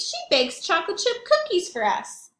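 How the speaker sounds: put-on kid's voice. Tommy defending Edwina to Reginald, high kid voice. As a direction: argue it like a child defending a friend. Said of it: "Then Tommy Brickcher asked".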